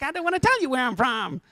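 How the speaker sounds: high-pitched exasperated protest; mock whiny refusal. South African to nosy Americans, mock whiny refusal. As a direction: refuse in a put-on high whine.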